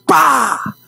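sharp "Pá!" of a hand hitting steel; imitated slam. Brother slamming the steel desk, sharp imitated bang. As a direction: smack the sound out.

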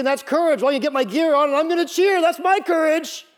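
loud, high-pitched sports-fan boast; mock fan bravado. Preacher mocks stadium fans who only cheer, loud high bravado. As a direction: crank it up like an overexcited spectator. Mocking cheering fans' idea of courage.

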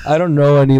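ordinary talk, nothing marked

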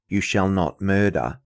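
ordinary talk, nothing marked

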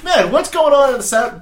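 a confused boss listening in; hammed-up puzzled CEO; mock CEO. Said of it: "one C E O ... just like".

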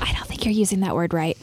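hushed unvoiced aside; whisper. Real, not acted. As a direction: whisper the correction. Whispered correction.

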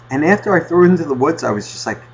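ordinary delivery

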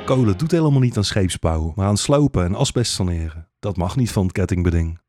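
ordinary delivery, nothing marked

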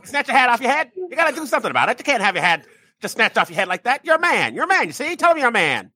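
high, fast pushy dad; old-timey dad. Retold or imitated. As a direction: rile up your son like an old-timey dad. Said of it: "dads were like".

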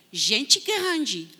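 usual speaking voice

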